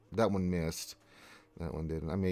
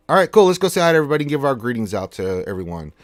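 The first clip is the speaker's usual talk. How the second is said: high, loud, fast burst of energy; excited kickoff; 6 semitones higher, 9 dB louder, faster than usual. Real, not acted. Streamer launching into greeting viewers, loud and excited. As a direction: pump up the chat greetings.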